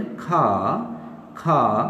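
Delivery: खा with a puffed k and open long vowel, no r; British "खा", breathy k release, silent r; British non-rhotic pronunciation. Shown as a demonstration. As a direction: say खा for "car", dropping the r. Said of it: "तो हम इसको यही पढ़ेंगे".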